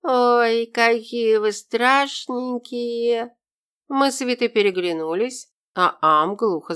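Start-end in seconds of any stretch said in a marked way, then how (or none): 0.0-3.4 put-on slow, high goofy drunk voice; silly drunk grin. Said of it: "с глупой улыбкой заявил". (глупая пьяная улыбка)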